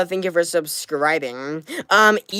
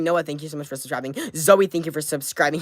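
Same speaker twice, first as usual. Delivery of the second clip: ordinary talk, nothing marked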